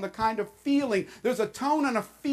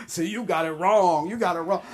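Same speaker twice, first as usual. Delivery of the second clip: mock congregant; 4 semitones lower than usual. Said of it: "I know what you're talking about".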